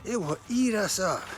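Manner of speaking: ordinary delivery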